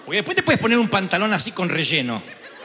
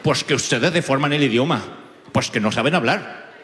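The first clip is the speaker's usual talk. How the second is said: mock accent from Spain, lower and scolding; Spanish accent; 6 semitones lower than usual. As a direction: imitate a Spaniard lecturing an Argentine, in a lower voice. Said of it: "Todos en España nos pasó igual". (acento español de burla, más grave y regañón)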